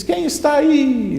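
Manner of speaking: high, loud, drawn-out question; shouted call. Acted. Boy challenges whoever hides in the valley, shouting. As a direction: holler into the valley. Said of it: "lá no fundo gritava".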